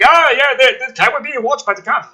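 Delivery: high, loud sudden dawning; mock realization. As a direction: act out a dumb late epiphany. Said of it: "just being like".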